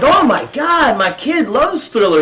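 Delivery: high, gushing mock excitement; high-pitched retold enthusiasm; excited coworker. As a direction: imitate a thrilled, gullible parent. Acting excited coworkers taken in.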